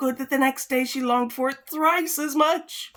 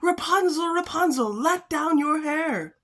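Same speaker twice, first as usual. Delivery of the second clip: calling out; 7 semitones higher than usual. Acted. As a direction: call up to the tower. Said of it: "listened while she called out".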